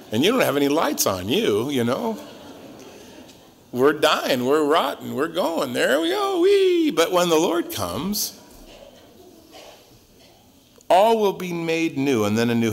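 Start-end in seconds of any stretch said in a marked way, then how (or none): 5.7-6.9 mock whee. Playful slide downhill, decay.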